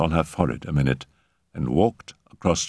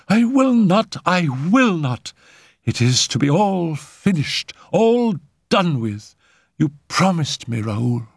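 high, vehement protest; agitated refusal; 10 semitones higher than usual. Acted. Simone refuses Raoul any more sittings, agitated. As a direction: refuse desperately, like a creature at bay.

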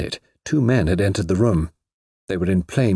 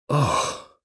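small high cry of dismay; distressed; 6 semitones higher than usual. A narrator's reading. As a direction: gasp it out in distress. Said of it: "with a deep accent of distress".

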